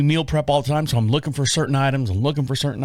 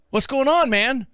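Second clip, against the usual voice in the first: high, bright-toned greeting call; friendly call; 10 semitones higher than usual. Retold or imitated. To the old man in the aisle, a high friendly call. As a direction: call out cheerfully.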